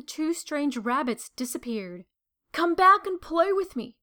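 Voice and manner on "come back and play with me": higher and louder calling after them. A narrator's reading. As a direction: call out after the fleeing rabbits. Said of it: "called the little rabbit".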